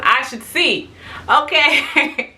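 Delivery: high-pitched mock disbelief; incredulous retort. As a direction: throw it back as an absurd suggestion. Incredulous retort to mom, retold.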